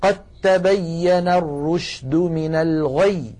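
drawn-out "قد تبين الرشد من الغي" with a doubled ت and no د; تلاوة بطيئة بتاء مشددة; slow Quranic recitation. Shown as a demonstration. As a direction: chant "قد تبين" as qattabayyana, slowly. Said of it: "هكذا".

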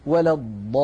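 tajweed chant of ولا الضالين with the long madd; Quran recitation. Shown as a demonstration. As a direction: recite it in the melodic Quran style. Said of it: "قوله تعالى". (تلاوة مجودة)

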